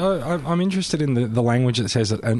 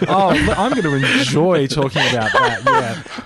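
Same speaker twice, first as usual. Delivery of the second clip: sarcastic mock delight, laughing; 4 semitones higher, 4 dB louder, slower than usual. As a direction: fake enthusiasm, tongue in cheek. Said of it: "that was sarcasm out of [name]'s voice".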